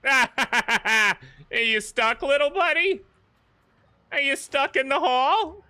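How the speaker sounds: very high, sing-song taunt; drawn-out, high-pitched fake sympathy; mocking baby talk. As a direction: laughingly tease a trapped little creature. Taunting a stuck game enemy.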